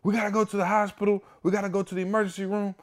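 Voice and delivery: high, rapid, frantic reenacted cry; acted-out frantic plea, racing and high; panicked screaming. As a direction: blurt it out in a high-pitched panic. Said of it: "screaming. I run in the house".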